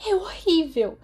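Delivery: a distraught student reading bad news; tearful. A narrator's reading. (chorando, aflita)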